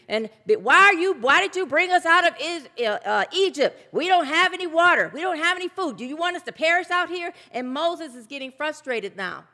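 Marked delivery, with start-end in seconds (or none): 0.5-7.3 loud, higher mock-complaining crowd; grumbling complaint. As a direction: whine at Moses like a thirsty, angry crowd. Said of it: "grumbling and upset and angry".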